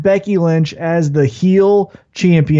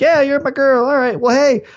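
playful Naomi voice; 6 semitones higher than usual. Retold or imitated. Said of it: "Naomi's like".

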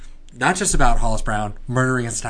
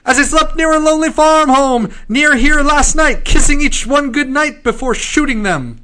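high, loud newsboy hawking headlines; old-timey paperboy crying the news; newspaper kid; 10 dB louder than usual. Pretend. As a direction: shout the headline like a street newsie. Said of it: "the newsy voice".

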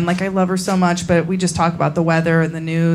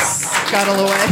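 ordinary talk, nothing marked

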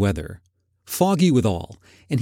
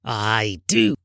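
low, drawn-out grumpy miser; Scrooge; 3 semitones lower than usual. A narrator's reading. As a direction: insist on it like a sour old man. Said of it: "said Scrooge".

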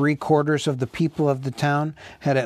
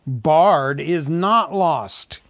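slow, raised, ringing declaration; crying out; 4 semitones higher, 5 dB louder, much slower than usual. A narrator's reading. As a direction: call it out slowly to the crowd. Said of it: "he cried".

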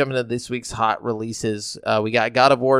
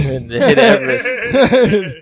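laughing; 11 semitones higher, 8 dB louder, much slower than usual. Real, not acted. Laughing while telling a joke.

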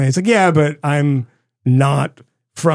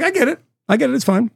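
high-pitched reassurance; 8 semitones higher, faster than usual. Retold or imitated. Retold self reply, high.

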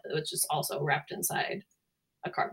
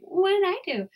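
very high, playful voice for a pet; cute innocent dog; 13 semitones higher, 4 dB louder than usual. Retold or imitated. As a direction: play the dog pleading innocence, pitched way up. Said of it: "she's so cute".

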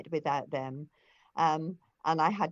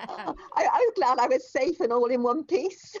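laughing; 7 semitones higher, 6 dB louder than usual. Real, not acted. Laughing at her own joke.

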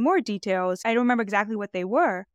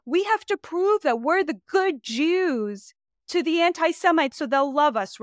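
higher, drawn-out mimicry of a pleading stance; mock Glazer position; 5 semitones higher than usual. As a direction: lightly parody someone anxious to prove himself. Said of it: "the Jonathan Glazer position of".

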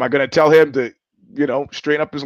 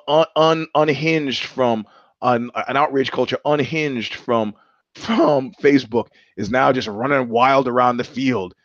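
normal talking voice

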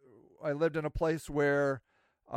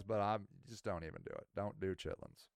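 low, quiet warning; 11 semitones lower, 13 dB quieter than usual. Retold or imitated. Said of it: "he says".

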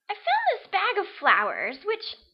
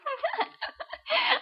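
flirty giggle; 7 semitones higher, 3 dB quieter than usual. Acted.

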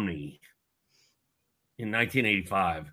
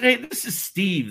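put-on eager kid voice; 11 semitones higher, 4 dB louder, slower than usual. Nervous kid calling star.

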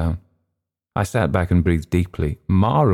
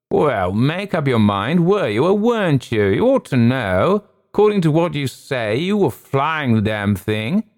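testy wing commander; 8 semitones higher than usual. A narrator's reading. Wing commander scolds pilot.

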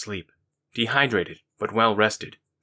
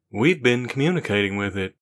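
a lightly voiced young man; Rando's voice. Rando tells Daniqua the news, lightly voiced. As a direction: give Rando a distinct but modest voice.

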